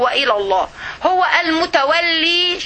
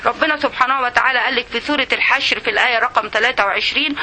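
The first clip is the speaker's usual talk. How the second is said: normal talking voice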